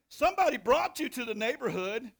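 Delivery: normal talking voice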